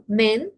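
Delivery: "Men" said slowly with a Spanish e; slow, clean Spanish-style e; Chinese men. Shown as a demonstration. Teacher showing that e sounds Spanish here. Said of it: "estoy pronunciando la E como una E en español".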